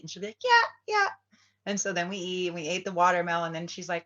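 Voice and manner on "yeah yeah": high, loud squeaky yeah; eager cat voice. Pretend. Voicing her cat eagerly agreeing to eat, high squeal.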